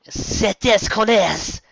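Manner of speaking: high, loud French curse; French cursing. Acted. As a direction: spit out a French oath. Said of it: "Trixie cursed".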